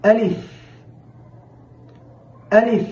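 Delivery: আলিফ said as a clear Arabic letter name; teacher sounding out আলিফ; Arabic letter demo. Shown as a demonstration. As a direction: model আলিফ slowly for students. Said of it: "আরবি ঊনত্রিশটি হরফ শেখা শুরু করি".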